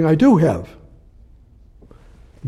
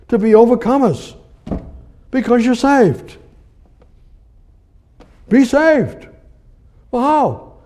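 preaching at full force; 6 semitones higher than usual. Real, not acted. Pastor urging listeners to look only to God, high and ringing. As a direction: preach it higher and higher. Preacher climax on being saved.

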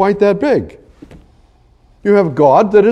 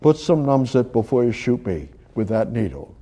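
quiet plea; 8 semitones lower, 8 dB quieter than usual. Retold or imitated. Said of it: "talk to the foot doctor".

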